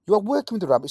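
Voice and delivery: ordinary talk, nothing marked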